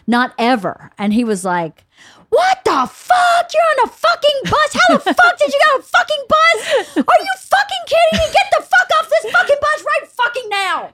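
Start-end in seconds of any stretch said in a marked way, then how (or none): 2.3-10.9 screeching high, enraged rant; high shrieking tirade of curses; furious cursing. Retold or imitated. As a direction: imitate a father losing it on the phone. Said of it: "he lost his mind on the phone with her, cursing".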